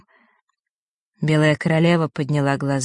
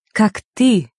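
ты hit higher and louder; stress on ты; 5 semitones higher, 4 dB louder than usual. Acted. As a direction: lean hard on ты. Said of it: "сделала особое ударение на «ты»".

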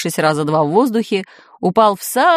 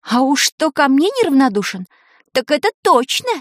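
higher-pitched teasing praise; mocking praise; 6 semitones higher than usual. A narrator's reading. Таня needles Шурасик to annoy him, mocking. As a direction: tease him sweetly in a raised voice.

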